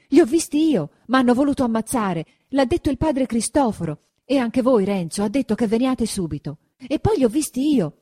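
put-on a terrified boy blurting out news; frightened, rushed report; breathless fright. A narrator's reading. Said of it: "riprese Menico ansante".

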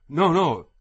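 loud, high refusal; insistent protest. A narrator's reading. The wounded German refuses concern, insistent protest. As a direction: wave off the helpers forcefully.

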